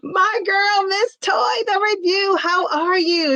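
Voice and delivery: high, ringing shout-out; excited welcome. Real, not acted. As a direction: greet her like a star arriving. Welcoming a favourite viewer.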